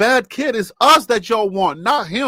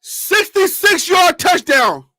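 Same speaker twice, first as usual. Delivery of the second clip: slow, high touchdown call; triumphant shout; 8 semitones higher, 3 dB louder, slower than usual. Real, not acted. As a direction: call it out high, stretching every word. Celebrating a long touchdown.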